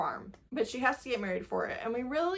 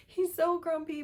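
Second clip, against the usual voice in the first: higher, delighted repeat; delighted higher squeal on grumpy; squealy gush; 8 semitones higher than usual. Real, not acted. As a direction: say it again, pitched up with glee. Repeats it after a laugh.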